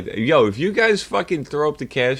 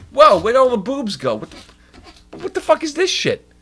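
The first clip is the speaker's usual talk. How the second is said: mock shock; 11 semitones higher, 6 dB louder than usual. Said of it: "It's like".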